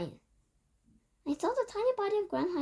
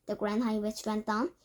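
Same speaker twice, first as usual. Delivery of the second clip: plain reading voice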